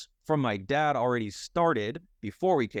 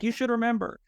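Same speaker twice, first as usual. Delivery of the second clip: high-pitched imitation of a chiding wife; lightly acted reproach in a raised voice; mock wifely scolding; 11 semitones higher than usual. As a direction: chide him like an exasperated wife. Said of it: "she'll kill me listening to this later".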